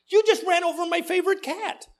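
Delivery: Judy rushing out to scold the pastor, high and upset; upset neighbor voice. Retold or imitated. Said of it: "she comes rushing out and she says".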